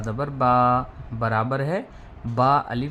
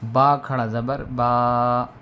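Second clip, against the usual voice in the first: drawn-out reading; 4 dB louder, slower than usual. Shown as a demonstration. Teacher showing students how long to hold बा. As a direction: stretch the final बा slowly and long. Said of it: "इतना खींच के".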